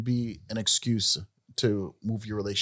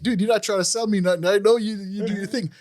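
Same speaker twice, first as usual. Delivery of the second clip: higher, fast, emphatic; mock reply to friend; 10 semitones higher, 6 dB louder than usual. Speaker answering his friend, lightly voiced upbeat reply. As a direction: brush off the pitch, upbeat. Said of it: "And I was like".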